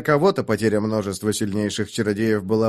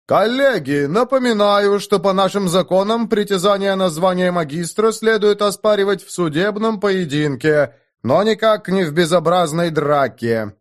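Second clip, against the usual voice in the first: warning; 7 semitones higher, 4 dB louder than usual. A narrator's reading. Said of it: "предупредил он".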